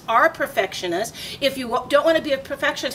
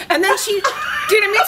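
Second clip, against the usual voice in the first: normal talking voice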